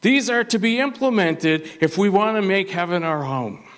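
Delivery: preaching. Acted. Preacher opens with exhortation.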